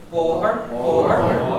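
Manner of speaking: boher with an open O, like "bo" in bother. Shown as a demonstration. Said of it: "We have it open".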